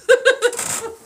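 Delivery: a burst of high, squealing laughter; laughing at own passion. Real, not acted. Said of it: "I just get very passionate sometimes".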